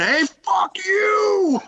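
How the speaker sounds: high, long drawn-out mock scream; hammed-up imitation of a buddy snapping; mock yell. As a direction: stretch the outburst out, laughing. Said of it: "pointed at him on his kayak and yelled".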